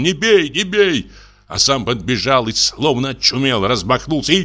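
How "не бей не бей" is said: high, slow, frantic plea to stop; high pleading shriek of a desperate man; desperate cry. A narrator's reading. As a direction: cry out in desperation as the husband rushes in. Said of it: "кричит отчаянным голосом".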